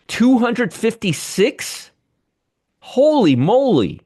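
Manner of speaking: high, slow, disbelieving; stunned surprise. Real, not acted. Host reacting to a shock jobs report, stunned and slow. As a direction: gasp at the surprise number.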